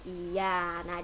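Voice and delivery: ordinary talk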